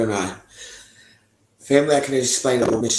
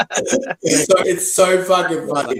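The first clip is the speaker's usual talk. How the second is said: laughing; 8 semitones higher, 8 dB louder, much slower than usual. Real, not acted. Laughing after retold message.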